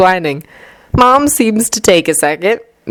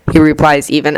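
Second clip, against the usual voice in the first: plain reading voice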